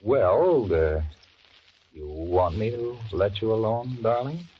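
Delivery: put-on hopeful wheedling to his girlfriend; coaxing. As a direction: sweet-talk her into agreeing. Coaxing her to let go.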